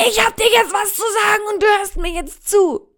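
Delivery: loud, high acted shout; wütendes Schreien. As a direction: yell it as you burst in, demanding a hearing. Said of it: "reißt du die Tür auf und schreist". (laut und aufgebracht geschrien)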